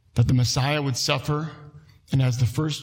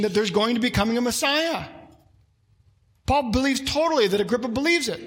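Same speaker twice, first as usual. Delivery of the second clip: preaching at full force; 6 semitones higher than usual. Acted. Pastor drives the point home to the congregation, preaching hard.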